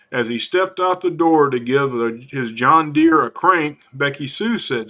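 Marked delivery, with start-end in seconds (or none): none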